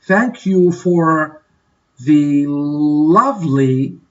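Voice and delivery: stretched slowly, word by word; slow model. Shown as a demonstration. Teacher showing guests how to thank hosts, slow. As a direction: draw out "Thank you for the lovely" slowly for learners. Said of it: "You can say".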